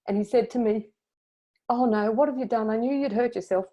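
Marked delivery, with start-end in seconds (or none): 1.7-3.7 high-pitched retold concern; fretful, raised-pitch mock alarm; worried husband. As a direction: imitate a worried husband fretting. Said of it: "he was very concerned".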